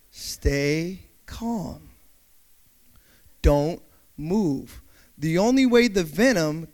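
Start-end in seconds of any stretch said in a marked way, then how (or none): 0.1-4.8 calm reassurance. Acted. Calming after the yell.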